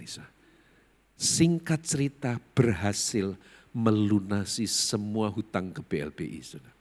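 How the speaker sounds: ordinary delivery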